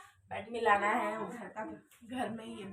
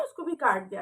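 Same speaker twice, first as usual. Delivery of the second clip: usual speaking voice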